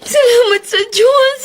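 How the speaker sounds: a woman thanking God through tears of relief; tearful thanks. Acted. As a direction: sob out her gratitude. Thanking God after recovery.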